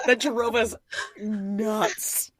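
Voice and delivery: usual speaking voice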